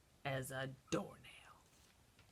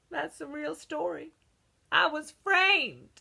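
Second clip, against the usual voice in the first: indignant; 8 semitones higher, 5 dB louder than usual. Acted. Wolf protests innocence.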